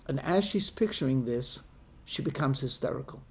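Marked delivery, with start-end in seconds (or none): none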